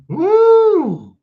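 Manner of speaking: high, loud whoop; excited whoop. Real, not acted. Teacher cheering a student's answer, a high loud whoop. Shout after scripture quote.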